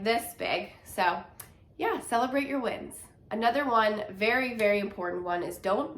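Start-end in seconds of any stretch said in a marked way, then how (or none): none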